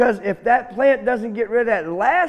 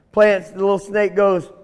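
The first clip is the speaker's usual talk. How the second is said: usual speaking voice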